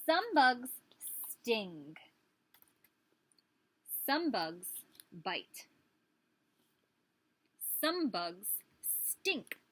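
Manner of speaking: sing-song picture-book read, each line lifted; lively teacher storytime voice; reading to children. Acted. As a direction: read it like a storybook for little kids.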